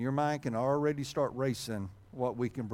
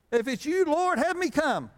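much higher and louder, hammed-up Peter; mock brash Peter; 12 semitones higher, 5 dB louder than usual. Preacher mimics Peter daring Jesus, hammed-up.

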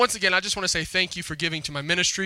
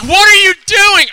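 much higher, louder mock exclamation; mock shocked outburst; 16 dB louder than usual. Pastor voices the congregation's reaction to his news, loudly incredulous. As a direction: imitate an incredulous outcry.

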